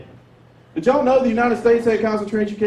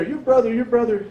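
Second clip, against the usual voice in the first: higher-pitched mock-tearful repetition; imitating sobbing mom; 5 semitones higher than usual. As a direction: play a distraught mother, lightly mocked. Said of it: "my mom is sobbing".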